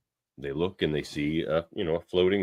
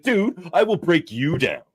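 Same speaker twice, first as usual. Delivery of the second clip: mock angry snap; 8 semitones higher, 6 dB louder than usual. Lily snapping at Shaxx while she works, mock angry threat. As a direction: snap at him like you're fed up. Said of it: "She's like".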